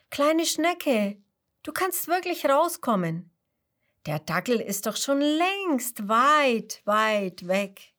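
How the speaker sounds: high, kindly reassuring bee voice; friendly bee. Acted. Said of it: "Die freundliche Biene Bianca antwortet".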